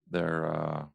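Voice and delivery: ordinary talk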